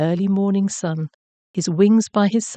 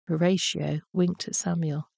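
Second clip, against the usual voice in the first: usual speaking voice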